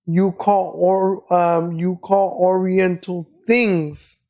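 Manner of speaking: slow, deliberate lecturing like a manager explaining; slow, spelled-out scolding. Retold or imitated. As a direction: talk it out slowly, word by word, as if to a slow learner. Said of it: "This is exactly what he said".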